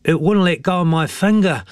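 put-on high boyish complaint; a touch of groaning in a high voice; mild groaning. A narrator's reading. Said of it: "groaned Hemi".

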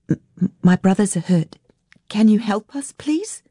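high, stammering plea rising at the end; pleading child. Acted. Girl begging strangers to help her brothers, pleading.

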